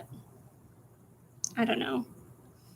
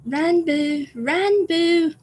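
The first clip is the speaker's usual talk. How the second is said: put-on high, drawn-out British-accented call; Tubbo impression; 6 semitones higher, 3 dB louder, much slower than usual. Trying to say it like Tubbo, British accent call. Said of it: "do it in a British accent".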